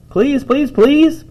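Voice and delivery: put-on very high, loud child begging; pleading cry. A narrator's reading. Boy begging his parents, high insistent pleading. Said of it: "cried Toby".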